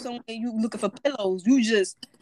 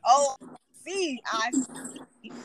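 drawn-out slow oh, I see; slowly, as the penny drops; mock realization; 3 semitones higher, much slower than usual. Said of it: "I was like".

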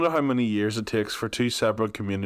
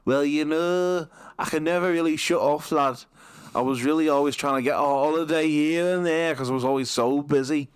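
Gary Neville impression; 5 semitones higher than usual. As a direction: play Gary Neville, Northern lad. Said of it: "He was like".

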